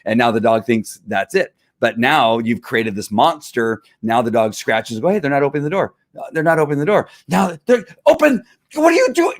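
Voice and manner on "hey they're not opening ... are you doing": building from plain to high, indignant dog; frantic dog voice. Retold or imitated. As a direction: voice the dog getting more and more upset. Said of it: "I went crazy there for a second".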